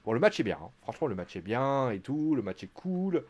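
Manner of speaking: usual speaking voice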